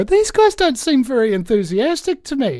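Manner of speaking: high, loud quoted remark. Retold or imitated. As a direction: call it over to a mate at a party. Acted aside to mate at party.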